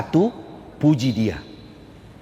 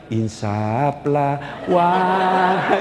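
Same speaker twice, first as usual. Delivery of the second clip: very slow, lower, drawn-out appeal; mock solemn plea; 3 semitones lower, much slower than usual. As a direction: stretch it out like a sermon to the wife. Ustaz soothing his angry wife. (bujukan sangat pelan dan khidmat)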